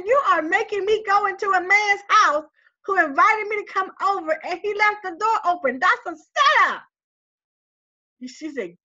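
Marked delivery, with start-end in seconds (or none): 0.1-6.8 upset tearful protest. Retold or imitated. And I just started crying.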